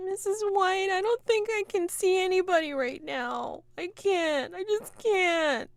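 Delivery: high, wailing grieving woman; sobbing. Acted. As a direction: wail it weakly into the pillow. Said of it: "sobbing 'cause you can see".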